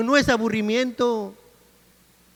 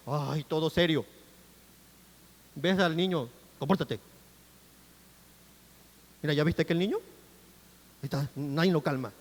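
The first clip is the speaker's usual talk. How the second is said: low, quiet, dour grumbling; mock serious churchgoer; 7 semitones lower, 6 dB quieter than usual. Preacher mimics a churchgoer watching someone's child, mock dour. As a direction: imitate a joyless worshipper fussing under their breath. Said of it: "no dice que vengas así como que".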